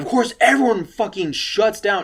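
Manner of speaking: usual speaking voice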